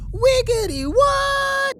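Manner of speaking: high, slow, stretched-out shout of disbelief; shocked shout. Acted. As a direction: bellow it in total disbelief. Shocked reaction to hummus fact.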